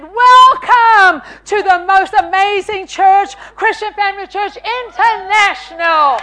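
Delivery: loud, high, booming church welcome; hammed-up host's proclamation; grand welcome. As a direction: announce it hugely, as if to a packed hall. Grand welcome to two visitors.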